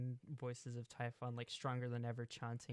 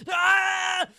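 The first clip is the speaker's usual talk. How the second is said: high loud cry of agony; pained scream; 22 dB louder than usual. Acted. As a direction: shriek as the flesh fuses. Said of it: "He's like".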